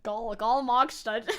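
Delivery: slow, loud, laughing mock Saxon; exaggerated Saxony accent, breaking into laughter; broader Saxony accent attempt. Shown as a demonstration. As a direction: ham up the Saxon vowels, slow and amused. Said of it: "I tried, okay? I tried".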